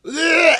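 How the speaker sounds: high, loud retching groan. Retold or imitated. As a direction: heave it out as you start vomiting. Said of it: "and I'm like".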